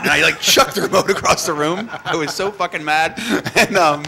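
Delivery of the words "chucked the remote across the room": ordinary delivery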